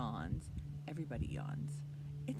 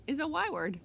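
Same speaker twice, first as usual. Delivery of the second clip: excited; 7 semitones higher, 8 dB louder than usual. Acted. Excited children's presenter.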